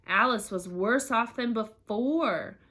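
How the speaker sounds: ordinary delivery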